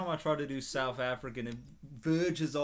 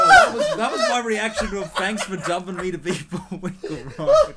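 high, loud, helpless laughter; cracking up mid-sentence; real laughing fit; laughing hard; 4 semitones higher, 14 dB louder than usual. Real, not acted. Cracking up after a bad skit.

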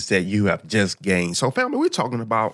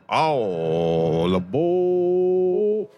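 conductor's call; 5 semitones higher, much slower than usual. Pretend. Conductor calling out again.

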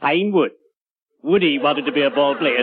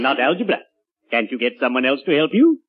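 ordinary delivery

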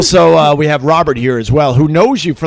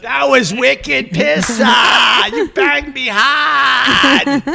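put-on high, slow, hollered Boston accent; hammed-up Boston girl shouting; 9 semitones higher, much slower than usual. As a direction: stretch the broad Boston vowels in a mock wail. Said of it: "do it in a Boston accent".

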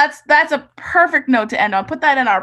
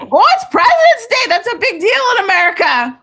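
playful excitement; 8 semitones higher, 5 dB louder than usual. Acted. Playful reaction to President's Day.

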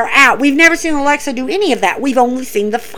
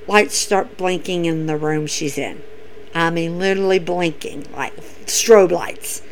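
hushed; 7 semitones lower, 9 dB quieter than usual. Real, not acted. Spooky buildup, voice drops.

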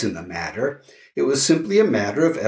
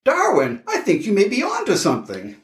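high, quick, thrilled; excited; 9 semitones higher than usual. Acted. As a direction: rush it out with excitement. Excited about his idea.

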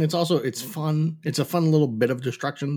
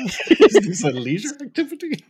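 higher and louder, laughing it back; laughing echo; 6 semitones higher, 4 dB louder, faster than usual. Retold or imitated. Co-host repeating Jack's line to host, laughing. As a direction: savor the line through laughter.

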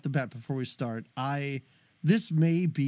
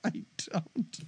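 whisper; 7 dB quieter than usual. Real, not acted. Joking denial to cohost.

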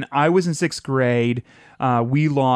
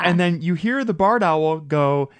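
ordinary talk, nothing marked

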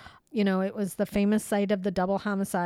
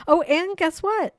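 higher, louder, eager; excited teasing; 8 semitones higher, 6 dB louder than usual. Real, not acted. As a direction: build up the surprise. Teasing big news.

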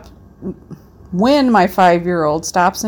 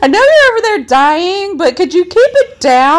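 put-on high, loud whiny child voice; bratty kid; 10 semitones higher, 6 dB louder than usual. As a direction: play the annoyed little kid. Acting the four-year-old's request.

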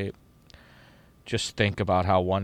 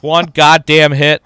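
loud, slow, higher disgusted mock grumble; grumbling announcer; 6 semitones higher, 14 dB louder, slower than usual. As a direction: drag out "goddamn" in fed-up disgust. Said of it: "He goes".

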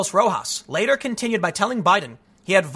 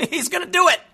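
laughing; 10 semitones higher than usual. Real, not acted. Laughing at Biden's remark.

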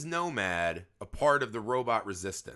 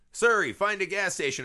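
loud voice command; 7 semitones higher, 5 dB louder than usual. Retold or imitated. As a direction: talk loud and clear to the phone.

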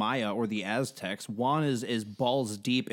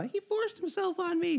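very high, soft, drawn-out whimper; mock victim; 4 dB quieter than usual. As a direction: put on a tiny high voice, feigning victimhood. Mocks person claiming to be a victim.

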